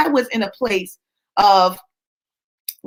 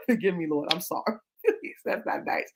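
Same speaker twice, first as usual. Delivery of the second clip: softer words squeezed through laughter; real laughing apology; 4 semitones lower, 10 dB quieter than usual. Real, not acted. Asking God's forgiveness for calling him ugly, laughing. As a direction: apologize while giggling, voice dropping away. Laughing at her own unkind joke.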